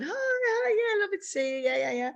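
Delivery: very high sing-song hellos; chirpy mock greeting. Walking in to meet the oncologist, high chirpy greeting. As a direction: greet them in a high, breezy voice. Acted greeting on entering clinic room.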